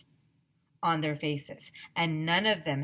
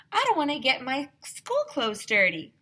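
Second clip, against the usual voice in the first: put-on high, prim girl's voice; Andrea's voice; 7 semitones higher, 4 dB louder than usual. A narrator's reading. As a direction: sound scandalised and proper. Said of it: "said Andrea".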